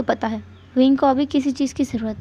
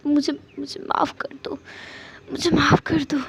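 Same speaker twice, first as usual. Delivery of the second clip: tearful apology. Acted. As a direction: beg forgiveness through tears. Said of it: "उसकी आंखों में आंसू थे". (रोते हुए माफ़ी मांगना)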